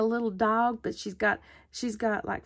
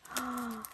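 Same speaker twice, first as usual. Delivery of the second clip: quick soft surprised gasp; a small delighted intake of breath; 9 dB quieter than usual. Real, not acted. Crafter digging into the gift bag, soft gasp.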